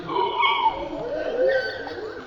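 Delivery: high, drawn-out gasp of fright; frightened gasp. Shown as a demonstration. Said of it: "She'll be frightened like this".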